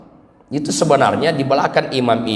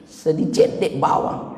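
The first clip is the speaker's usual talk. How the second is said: mock whisper; 5 dB quieter, slower than usual. Joke about fake grief.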